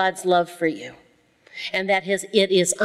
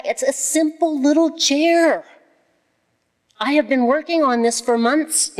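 high-pitched exasperated outburst; hammed-up frustration at a stubborn project; comic exasperation; 8 semitones higher than usual. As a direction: let frustration push the voice way up. Comic rant about stubborn chair project.

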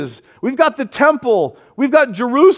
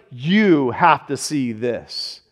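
lower, softer and very slow, every word drawn out; hushed urging; 7 semitones lower, 4 dB quieter, much slower than usual. Retold or imitated. Pastor telling the church they must see it, slow hushed awe. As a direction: let the wonder weigh each word. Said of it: "where it's like".

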